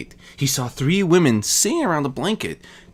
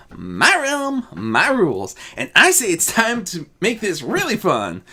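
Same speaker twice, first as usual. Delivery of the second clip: higher, cheeky comeback; playful counter; 7 semitones higher than usual. Acted. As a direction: overrule them with glee. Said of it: "Azura countered".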